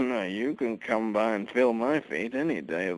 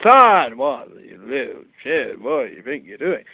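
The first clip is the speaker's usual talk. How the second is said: angry father; 2 semitones higher, 13 dB louder than usual. Retold or imitated. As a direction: yell at the son caught in the act. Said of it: "my father walks in once and said".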